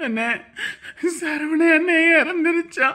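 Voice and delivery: high, slow wail of grief; crying. Acted. Caller tells of Saravana's death by phone, crying. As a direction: break down sobbing on the words. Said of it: "ஏன் அழுவுற?".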